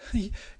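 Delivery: high, forced nervous laugh; a brittle laugh on the edge of panic; short high laugh, not amused; shrill unnatural laugh. Acted. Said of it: "She laughed a shrill, unnatural laugh".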